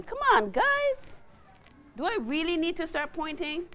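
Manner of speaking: exasperated urging. Real, not acted. Teacher prodding students who won't volunteer, exasperated urging.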